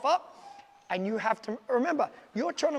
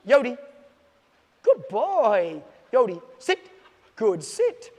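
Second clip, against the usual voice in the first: lively dog commands; 3 semitones higher, 6 dB louder, slower than usual. Shown as a demonstration. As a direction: put real inflection into each command. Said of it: "Use your voice inflection".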